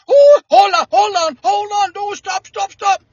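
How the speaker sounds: high, urgent Cajun pleading; Cajun accent. Retold or imitated. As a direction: rush to stop him, Cajun and high. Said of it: "well, he was a Cajun".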